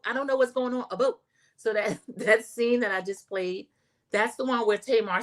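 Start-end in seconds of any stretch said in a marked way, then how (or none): 0.9-1.2 mock Canadian "about" said as "a boat"; Canadian accent. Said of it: "It sounds Canadian to me".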